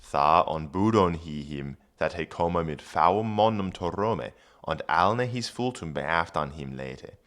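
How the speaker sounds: put-on Old English read aloud; careful Old English reading. Shown as a demonstration. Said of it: "reads Old English".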